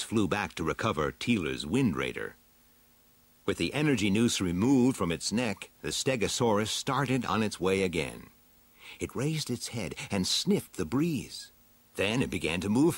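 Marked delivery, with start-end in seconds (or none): none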